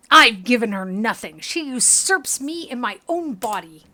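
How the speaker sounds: loud, indignant outburst; pained anger. Acted. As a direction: force it out through gritted teeth. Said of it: "he grits his teeth painfully".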